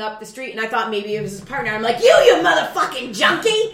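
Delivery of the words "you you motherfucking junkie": high, loud insult; furious yell. Retold or imitated. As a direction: hurl it at him. Yelling at passerby.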